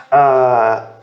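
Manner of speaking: ordinary talk, nothing marked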